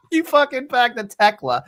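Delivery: hammed-up Boston jab; Boston accent. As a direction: tease in a thick put-on Boston accent. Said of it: "the Boston accent does not help".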